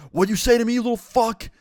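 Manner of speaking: high, loud outraged snap back; angry retort. Retold or imitated. Dad snapping back at his son at the door, furious. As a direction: bite back hard at the insult.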